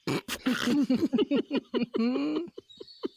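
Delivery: long helpless laugh; burst of laughter. Acted. Husband laughing at his wife's joke, helpless laughter. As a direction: crack up at her joke.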